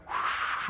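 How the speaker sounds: blown imitation of a gust; whooshing wind. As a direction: make a windstorm noise with your breath. Imitates the wind Elijah hears.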